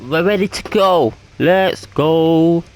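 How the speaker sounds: loud, high burst of race-start hype; pumped shout to get going; excited hype. Real, not acted.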